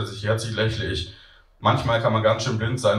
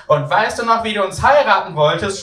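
put-on high, loud child's yell while dragged off; a boy calling back gleefully; shouting child; 11 semitones higher, 6 dB louder than usual. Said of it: "schreit er im Wegschleifen".

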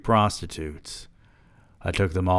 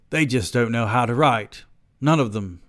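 slightly higher British voice; British accent; 4 semitones higher than usual. Acted. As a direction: pronounce judgment in a British accent. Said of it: "giving this man a British accent".

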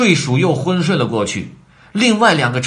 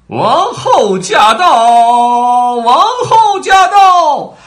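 long, drawn-out herald's shout, much higher and louder; high ringing announcement; 13 semitones higher, 11 dB louder, much slower than usual. A narrator's reading. Herald announcing the Queen's arrival, drawn-out high shout. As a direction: call it out slowly across the whole garden. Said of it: "传来了高亢的声音".

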